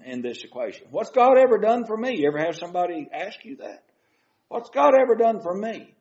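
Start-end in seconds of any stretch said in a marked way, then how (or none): 0.9-2.2 imitated complaint, pitched high; blaming God. Church member blaming God over a hard life, imitated reproach. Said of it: "and they blame God". 4.5-5.9 imitated complaint, pitched high; blaming God. Church member blaming God over a hard life, imitated reproach. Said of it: "and they blame God".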